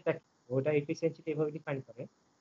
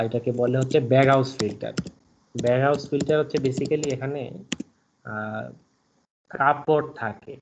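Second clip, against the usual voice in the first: louder lecturing voice; speaking up; 6 dB louder than usual. Real, not acted. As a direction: project louder over a bad line. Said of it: "আমি এখন চেষ্টা করতেছি এইভাবে কথা বলার".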